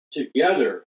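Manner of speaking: emphatic, weighty Together; stress on Together. Real, not acted. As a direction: hit the word Together hard. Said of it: "let me emphasize the word".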